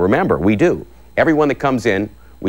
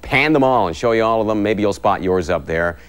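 ordinary delivery

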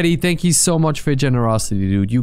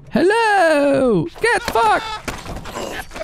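mocking taunt; much slower than usual. Taunting opponent in game.

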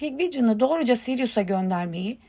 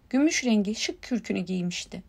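ordinary delivery, nothing marked

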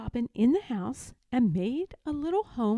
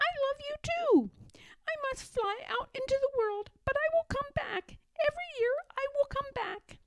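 very high, quick bird voice; loving little robin; faster than usual. A narrator's reading. Robin promising Mother Bear return, high and quick. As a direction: promise it in a tiny, fast squeak.

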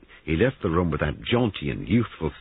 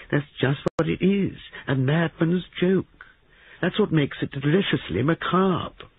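high, impish relish; gleeful young man; 13 semitones higher than usual. Acted. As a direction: savour the macabre joke, voice pitched high. Christopher gleefully defends his joke.

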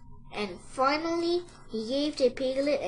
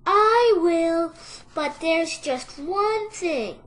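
high piglet voice, slowing down; drawn-out, high-pitched piglet; sighing piglet; 6 semitones higher, 7 dB louder, slower than usual. A narrator's reading. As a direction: agree reluctantly in a high voice. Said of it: "sighed the piglet".

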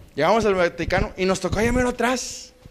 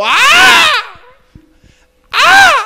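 very high, loud held cry; imitated high holler; grandfather's yell; 9 dB louder than usual. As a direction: let out grandpa's piercing "¡ah!". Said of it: "Gritaba mi abuelo".

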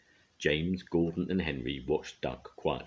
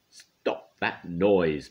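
loud, drawn-out command; bellowing; 3 semitones higher, 7 dB louder, much slower than usual. A narrator's reading. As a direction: bellow it slowly as an order. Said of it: "bellowed the Fat Controller".

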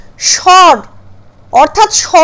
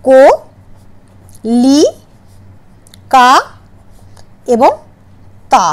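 কো, লি, কা, তা split with long pauses; syllable by syllable; 3 semitones lower, slower than usual. Shown as a demonstration. Teacher dividing Calcutta into syllables for the class, long pauses between. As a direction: break Calcutta into four separate syllables. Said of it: "Calcutta শব্দটার দল বিভাজন করি".

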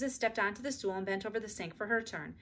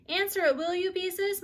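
high, louder call from downstairs; mother calling her daughter to the phone; 10 semitones higher, 5 dB louder than usual. A narrator's reading. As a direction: call up from the basement. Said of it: "Mother called".

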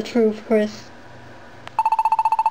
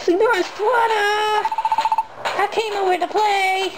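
much higher, whiny cartoon voice; hungry pushy character; 9 semitones higher than usual. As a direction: demand food in a high, childish cartoon voice. Fan-dub, hungry character barges in.

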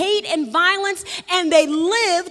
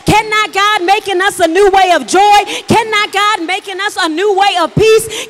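loud, higher, hammering each question; full-force preaching; 4 semitones higher, 9 dB louder than usual. Acted. Preacher rousing his congregation with rising questions, loud and fervent. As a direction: preach it to the rafters.

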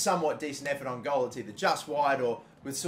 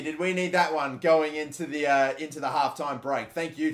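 ordinary talk

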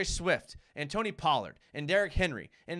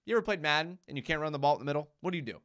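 normal talking voice

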